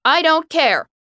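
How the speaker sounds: loud, high angry snap; snapped. A narrator's reading. Girl snaps back at her mother, angry. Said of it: "Justine snapped".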